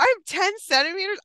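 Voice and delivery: high, loud, amazed question; elated disbelief. Retold or imitated. Mother reliving news of her dilation, elated disbelief. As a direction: exclaim it as if stunned by great news.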